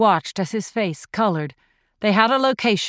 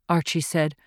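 usual speaking voice

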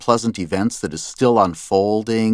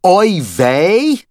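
high, louder indignant "Oi weh?"; mock yell; 10 semitones higher, 5 dB louder than usual. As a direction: throw the word back at him in disbelief. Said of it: "The producer was yelling into my headphones".

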